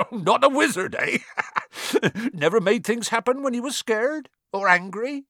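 amused, high-pitched, laughing through the words; chuckling. A narrator's reading. Hagrid teasing doubtful Harry, chuckling. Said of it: "Hagrid chuckled".

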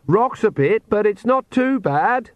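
high-pitched call over a distance; calling back. A narrator's reading. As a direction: call back a drawn-out reassurance. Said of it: "she called back".

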